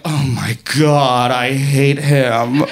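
low, drawn-out loathing; fed-up wife. Retold or imitated. Wife beside her obnoxious husband, slow low exasperation. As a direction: groan the words slowly in weary disgust.

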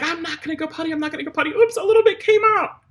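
high, loud pleading dog; whiny dog voice. Pretend. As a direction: beg like a dog who wants its walk.

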